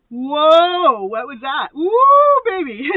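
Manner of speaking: big, loud, high "whoa" turning to a whoop; startled thrill. Acted. Reader acting out feeling a soulmate's energy, startled thrill. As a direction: blurt it out as if jolted by a thrill.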